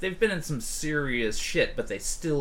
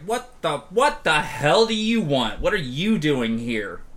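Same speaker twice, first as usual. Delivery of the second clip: louder, sharp startled demand; Robbie, alarmed; 3 semitones higher, 5 dB louder than usual. Acted. Bartender confronts the old acquaintance, alarmed and angry. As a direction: bark at the intruder, higher. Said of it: "Robbie is very much just like".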